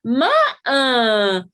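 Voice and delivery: sing-song "ma, uh" with the consonants missing; prosody only. Shown as a demonstration. Clinician imitating a child with few sounds, tune-only "ma, uh". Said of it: "they might use prosody to help be understood".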